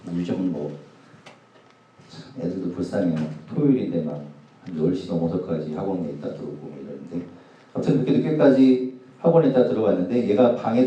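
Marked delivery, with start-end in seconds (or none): none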